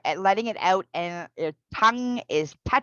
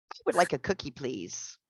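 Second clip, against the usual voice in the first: softer, polite would like with please fading low; polite request; 4 semitones lower, 6 dB quieter than usual. Shown as a demonstration. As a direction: ask gently and politely. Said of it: "Different tone".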